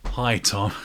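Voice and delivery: ordinary delivery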